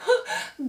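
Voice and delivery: a sharp, very high gasp; high-pitched shocked gasp. Real, not acted. Reacting to the scandal.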